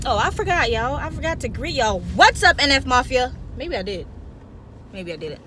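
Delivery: usual speaking voice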